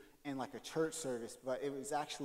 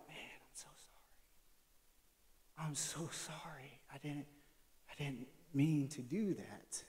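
whispered apology; 2 semitones lower, 9 dB quieter than usual. Retold or imitated. Pastor whispering sorry to someone who is sad. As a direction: hush it like consoling a sad friend. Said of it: "I'm like".

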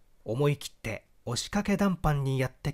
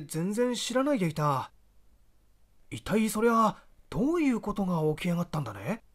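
higher, rough country brother asking sharply; a provincial elder brother, pressing for answers; rustic older brother; 6 semitones higher than usual. Acted. The elder brother pressing his cousin about the trouble, higher and rough.

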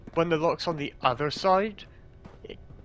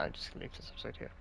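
fast, much softer whisper to himself; whispered aside; 4 semitones lower, 16 dB quieter, much faster than usual. Real, not acted. Host deciding to end the episode, whispered aside. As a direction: mutter the decision under his breath. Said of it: "Nah, nah".

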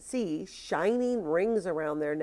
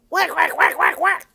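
loud, high, rapid quacking; duck crying out; 10 semitones higher, 11 dB louder than usual. A narrator's reading. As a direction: quack wildly like a caught duck. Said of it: "cried Ping".

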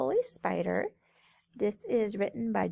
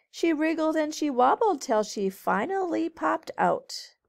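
lilting storybook read-aloud; reading to children; 5 dB louder than usual. A narrator's reading. Teacher reading a rhyming book to kids, lilting. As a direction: read it brightly to a group of kids.

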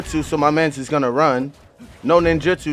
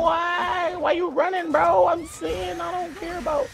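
much higher put-on jeer; mock him in a silly high voice; teasing the fleeing player; squeaky mock taunt; 14 semitones higher, 4 dB quieter than usual. Real, not acted.

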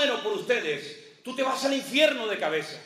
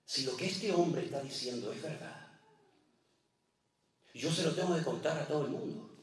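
hushed awe; 10 semitones lower, 7 dB quieter than usual. Retold or imitated. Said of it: "yo decía".

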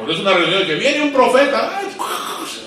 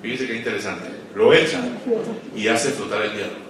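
quiet intimate preaching; 10 semitones lower, 10 dB quieter than usual. Acted. Preacher closing his point to pastors, low and soft. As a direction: draw them in with a hush.

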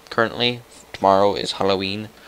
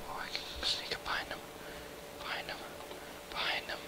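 hushed, barely audible whisper; very soft conspiratorial whisper; sneaky whisper; 16 dB quieter, much faster than usual. Real, not acted. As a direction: whisper it as if creeping up unnoticed. Sneaking up behind another player.

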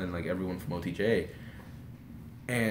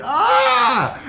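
very high, loud scream; fangirl scream; 13 dB louder than usual. Retold or imitated. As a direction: shriek with overwhelmed joy. Retold fan freak-out after hug.

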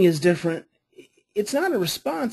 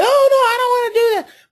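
high, louder mock child whine; pitched-up imitation of a refusing child; whiny child refusal; 14 semitones higher, 7 dB louder than usual. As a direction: play the kid digging in with a whine. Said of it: "they're gonna be like".

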